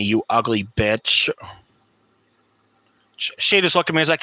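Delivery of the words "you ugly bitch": lower, slower, cold retold insult. Telling off the teacher in class, slow low insult. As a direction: drop the voice low and spit it slowly.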